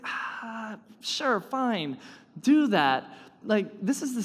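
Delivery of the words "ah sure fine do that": shrugging. Retold or imitated. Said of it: "it's like he's saying".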